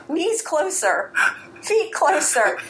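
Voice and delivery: high, drawn-out imitation of a teacher calling out; mock yelled commands. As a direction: imitate a teacher's called corrections, slow and high. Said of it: "nobody ever yells at me".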